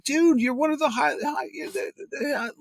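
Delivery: high, fast panicked disbelief; freaking out. Retold or imitated. Host on the phone to a star artist cancelling, high panicked pleading. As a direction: squeak out your alarm at the no-show. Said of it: "Neil's listening to me freaking out".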